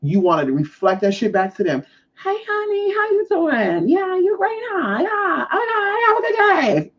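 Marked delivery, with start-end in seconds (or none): 2.2-6.9 very high, syrupy put-on chirp; fake as fuck. Reflecting the neighbour's energy back, fake friendly. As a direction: gush in a phony high sing-song. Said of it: "And you being fake as fuck".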